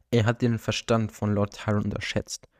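ordinary delivery, nothing marked

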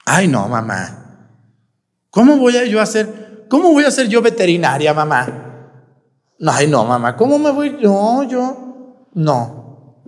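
put-on whiny teenage daughter. Daughter whining to her mother about becoming a vet. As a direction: beg your way out of studying.

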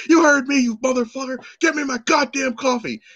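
high, forceful imitation of a raging customer; retold angry screaming. Drunk customer cursing out the young cashier, retold angry screaming. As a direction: yell it as the furious drunk demanding service. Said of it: "he starts screaming at me".

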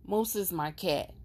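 ordinary talk

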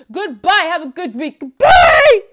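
high, loud shouted farewell; loud goodbye. Pretend. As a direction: belt out the goodbye. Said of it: "I don't end my podcast like this".

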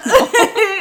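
high laughing no; cracking up. Real, not acted. Laughing at innuendo.

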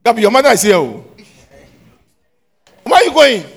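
loud, high call across the room. Real, not acted. Teacher calling loudly to Gabby as she walks away. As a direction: call her name loudly from afar.

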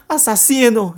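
high, loud accusing yell; a furious shout at the killer. Acted. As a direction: scream the accusation. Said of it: "gritou Júlia".

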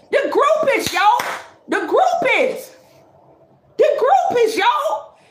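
high, giggly repeating; cracking up at the word; laughing through each line. Real, not acted.

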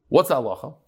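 ordinary talk

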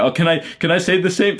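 high, put-on whine mimicking a lazy complainer; mocking whiny voice. Mocking people who can't list three things, in a high whine. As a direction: sneer it back in a silly high voice. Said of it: "they have the audacity to say".

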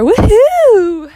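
high, loud woohoo; a joyful high-pitched cheer; excited cheer. Real, not acted. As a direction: whoop with real delight. Cheering about being voiced over.

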